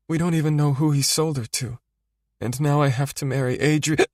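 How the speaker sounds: high, tearful voice; crying. Acted. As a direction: speak through tears. Nora crying to Rocco.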